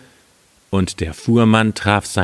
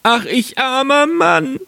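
wailing lament. Acted. Carter finding his wine lost, wailing in high voice. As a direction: bemoan your bad luck, stretched out high. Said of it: "rief er wieder".

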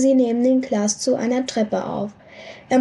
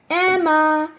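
distant mother calling; 5 semitones higher than usual. A narrator's reading. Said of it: "eine Frauenstimme aus der Ferne".

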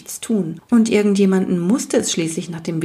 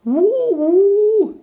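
high loud rapturous owl sigh; delighted hoot; 13 semitones higher, 4 dB louder than usual. Acted. Night goblin hears of the swamp, delighted hoot. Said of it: "seufzte der Nachtalb entzückt".

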